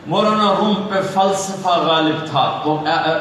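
ordinary talk

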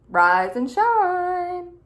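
high, slow, loud sing-song holler; hollered wake-up. Acted. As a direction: holler it long to wake everyone. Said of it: "Snippet hollered".

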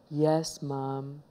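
very soft, low answer; meek submission. Acted. Scolded boy staring at his feet, meek quiet reply. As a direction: give in, barely audible.

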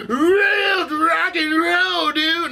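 high, drawn-out rock yell; mock rocker. Host ridicules the cartoon rival team, mock rocker wail. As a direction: wail it like a hammed-up rock singer.

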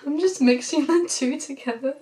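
ordinary talk

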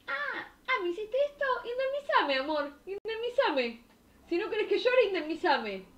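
whiny demanding woman. Pretend. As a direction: whine for money like a spoiled girlfriend. Mocking ex-wife demanding compensation. (novia caprichosa que exige plata, voz muy aguda y quejosa)

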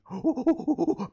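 high excited monkey gasp; monkey squeal. Acted. Monkey sound for class.

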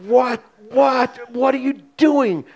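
a retold panicked what are you doing; alarmed disbelief. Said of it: "And I was going".

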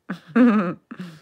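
loud burst of laughter; amused laugh. Real, not acted. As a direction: laugh at your own struggle to explain. Amused after a hard explanation.

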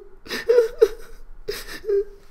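long high wailing sob; Fluttershy breaking down in tears; high sobbing wail. Acted. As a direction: let out a crying wail. Crying after fleeing home.

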